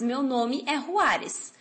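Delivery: translate slowly and clearly; slow translation. Shown as a demonstration. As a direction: lay out each word of the Portuguese slowly. (tradução bem devagar)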